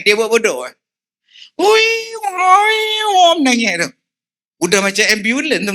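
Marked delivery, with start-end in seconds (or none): 1.6-3.9 high mock scolding. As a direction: shoo a crying child away. Mimicking scolding a crying child. (teguran yang tinggi dan mengejek)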